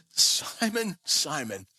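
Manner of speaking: a hint of a tender, falling call of the name; quietly sorrowful name call; lightly empathetic. Jesus prepares Peter for failure, a hint of tenderness. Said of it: "empathetic tone in his voice".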